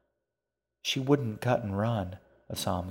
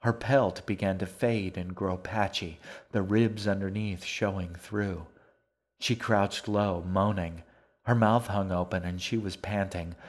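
ordinary delivery

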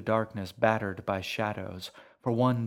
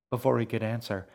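ordinary delivery

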